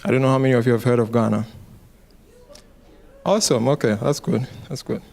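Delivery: usual speaking voice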